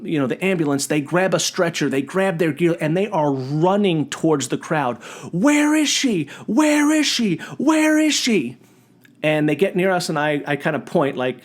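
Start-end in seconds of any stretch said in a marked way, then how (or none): 5.3-8.6 high-pitched mock frantic call-out; urgent repeated cry, pitched far up, hammed-up; imitated urgent calling. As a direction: act out paramedics calling as they run to the crowd. Paramedics running towards the crowd.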